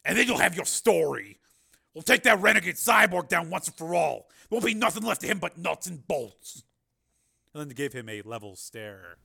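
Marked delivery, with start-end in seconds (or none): 0.0-6.6 higher, louder, cocksure vow; confident. A narrator's reading. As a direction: promise victory with swagger. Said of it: "said the marshal confidently".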